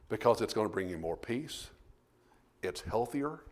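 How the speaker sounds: ordinary talk, nothing marked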